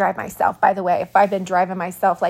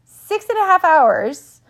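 higher, louder disbelieving repeat with a rise; incredulous repeat; 9 semitones higher, 6 dB louder than usual. Real, not acted. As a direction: stress the wait in disbelief.

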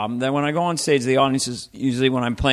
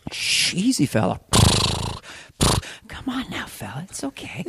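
hushed, higher soothing murmur; gentle a nervous horse down; horse whisperer; 6 semitones higher than usual. Acted. Horse whisperer calming a skittish horse, hushed. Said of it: "a horse whisperer with a temper".

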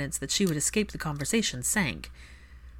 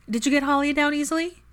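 high, louder calling question; pitched-up friendly call; bright question; 10 semitones higher, 5 dB louder than usual. Acted. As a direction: call it across the room, high.

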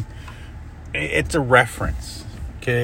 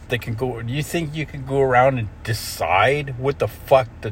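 worked up rant; 3 semitones higher than usual. Real, not acted. Ranting straight at Mark, furious and climbing. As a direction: tear into him, voice climbing. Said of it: "got myself worked up again here".

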